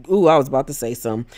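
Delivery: ordinary delivery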